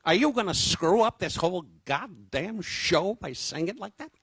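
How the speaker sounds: high, loud mock tirade at a costar; imitating Don blowing up over a line reading; angry Don. Don scolding his costar during the sketch, hammed-up fury. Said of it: "he would say".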